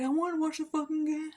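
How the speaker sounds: Jason's tantrum voice. Retold or imitated. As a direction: imitate him whining like a two-year-old. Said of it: "He's like".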